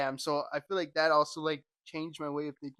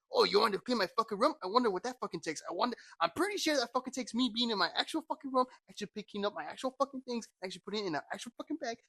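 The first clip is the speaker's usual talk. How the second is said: higher-pitched sarcastic tirade; scornful put-down, hitting every "fucking"; mocking rant; 6 semitones higher, faster than usual. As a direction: spell it out as if to an idiot. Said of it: "making that other person the dumbest person ever".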